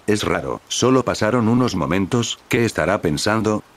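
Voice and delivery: ordinary delivery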